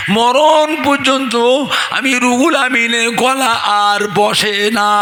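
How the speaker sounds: slow, high, full-voiced declamation; ringing, drawn-out proclamation; preaching climax. Real, not acted. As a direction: stretch every word out to the crowd. Climax of the miracle story.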